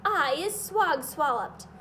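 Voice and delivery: loud, slow bellow; roaring giant bellow. A narrator's reading. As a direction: boom it out furiously. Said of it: "bellowed the bone cruncher".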